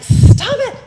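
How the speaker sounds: high, very loud "Stop it!"; sharp shout. Retold or imitated. Boss stopping two yelling coworkers, loud sharp shout. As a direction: cut the two of them off with a yell. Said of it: "I'm like".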